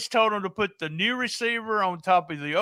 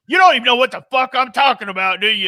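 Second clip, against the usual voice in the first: mock yell; 5 semitones higher, 9 dB louder, faster than usual. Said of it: "he goes".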